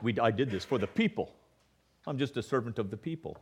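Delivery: lightly voiced politician claiming service; mock politician excuse. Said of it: "teach our politicians".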